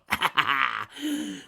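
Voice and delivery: rising villain laugh; evil cackle. Acted. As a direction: cackle gleefully. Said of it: "he cackled".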